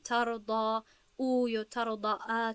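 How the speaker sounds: slow ترضا او then ترضا ا with each ending vowel stretched; demonstrating vowel endings. Shown as a demonstration. Teacher testing endings on ترضا for the class, slow. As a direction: slowly try on the endings او and ا on ترضا.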